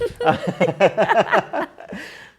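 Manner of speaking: high, loud burst of laughter. Real, not acted. Friend cracking up during a chat, loud laugh.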